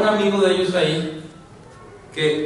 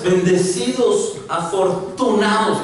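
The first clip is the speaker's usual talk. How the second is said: higher, drawn-out words of blessing; emphatic proclamation; 5 semitones higher, slower than usual. Acted. Preacher repeating Jesus' words to the congregation, emphatic. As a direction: proclaim each word slowly.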